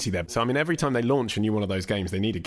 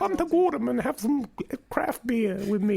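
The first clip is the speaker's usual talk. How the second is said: channeling Affleck. Retold or imitated. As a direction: sound like a tiny old Southern lady. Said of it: "just channeling Affleck".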